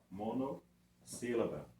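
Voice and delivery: "monossílaba" slowly, pausing after mono; term broken up. Shown as a demonstration. Teacher introducing the term for one syllable, "monossílaba" in two parts. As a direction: set "mono" apart from "sílaba". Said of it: "é esse nome".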